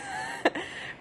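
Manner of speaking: short high giggle; quick giggle; high laugh. Real, not acted. Laughs at deathbed joke.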